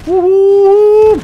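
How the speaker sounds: high, loud whoop of joy; excited whoop. Real, not acted. Gamer celebrating a win, loud high whoop. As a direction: let out a big celebratory whoop.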